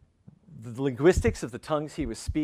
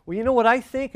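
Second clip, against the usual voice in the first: challenging street guy; 9 semitones higher, 4 dB louder than usual. Retold or imitated. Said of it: "He said".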